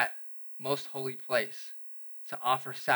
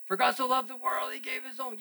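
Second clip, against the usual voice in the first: dying man quoting; 11 semitones higher than usual. Retold or imitated. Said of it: "moaning on a deathbed".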